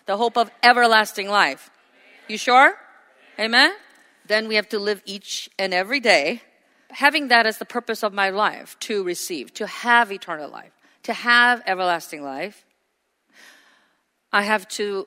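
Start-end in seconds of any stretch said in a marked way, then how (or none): none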